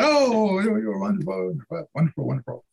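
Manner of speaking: high, adoring mother imitation, easing down; gushing mock praise. Mother praising her son after his show, imitated gushing. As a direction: gush like a doting mother, starting high.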